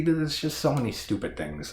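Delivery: ordinary delivery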